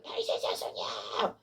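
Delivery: very high, strained, drawn-out yell. Retold or imitated. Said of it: "더 큰 소리로 외친 거예요".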